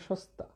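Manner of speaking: সস্তা split as shos-ta; pronunciation demo. Shown as a demonstration. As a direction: keep a clear s before the t in সস্তা, sh at the start. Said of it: "তাহলে দেখো". (সস্তা ভেঙে শস্-তা উচ্চারণ)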